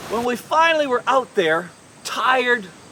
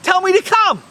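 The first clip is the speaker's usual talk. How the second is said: high, loud plea across the waves; terrified call; 9 semitones higher, 5 dB louder than usual. Acted. Fisherman asking Jesus to call him, terrified shout. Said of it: "he was absolutely terrified".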